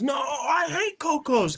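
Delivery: high, slow mock whine of a little kid; imitating a whining kid; whiny kindergartner. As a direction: whine like a stubborn five-year-old. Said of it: "Kids in kinder".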